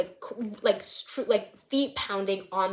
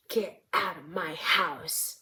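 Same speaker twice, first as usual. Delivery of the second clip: low, slow menacing order; acted controlled anger, drawn out; angry stepdad; 4 semitones lower, slower than usual. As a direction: order them out slowly in a lowered voice. Angry stepdad, red, throws Noah out.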